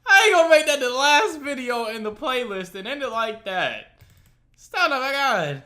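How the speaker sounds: high, loud indignant rant; outraged protest. Real, not acted. Vlogger to viewers, loud and high, outraged. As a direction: protest like the ending is unfair.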